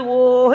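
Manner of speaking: loud, held-out mock bawl; imitated sobbing howl. Storyteller showing the boy crying before his mother, loud mock wail. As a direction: swell one long vowel like a crying boy. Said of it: "ఏడుస్తున్నాడు".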